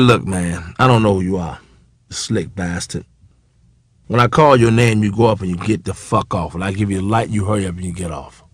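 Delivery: lightly acted low, curt threat; understated contempt, voice held low; hostile warning. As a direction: warn them off in a low, softened voice. Acts Slappy's hostile warning.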